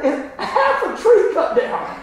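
high, slow, laughing through it. Real, not acted. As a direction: giggle out the absurd detail. Laughing at neighbor's half-done jobs.

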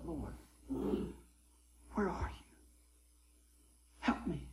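near-whispered retold prayer; hushed pleading. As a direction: plead softly under the breath. Retold desperate prayer, nearly whispered.

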